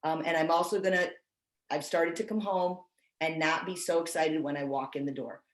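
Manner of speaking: normal talking voice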